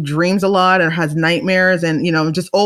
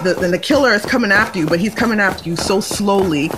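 normal talking voice

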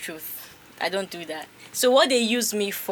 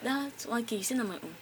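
brother's soft plea; 12 dB quieter than usual. Retold or imitated. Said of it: "He's like".

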